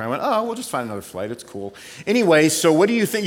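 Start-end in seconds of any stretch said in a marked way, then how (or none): 0.2-1.7 unbothered. Retold or imitated. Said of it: "I'm a classic non-reactor".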